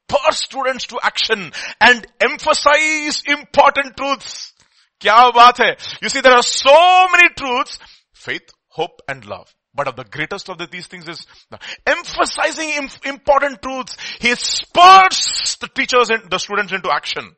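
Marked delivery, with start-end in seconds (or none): 0.1-7.8 high, slow and loud emphasis; excited preaching. Real, not acted. 11.9-17.3 excited preaching. Real, not acted. Preaching emphasis.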